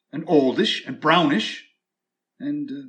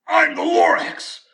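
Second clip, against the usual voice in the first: high, loud, sharp retort; snapped; 11 semitones higher, 4 dB louder than usual. Acted. Lorax at the office door, snapping high and loud. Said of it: "He snapped".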